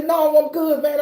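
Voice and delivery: high, hurried-off mock protest; pleading refusal. Exerciser turns down approaching woman, high pleading refusal.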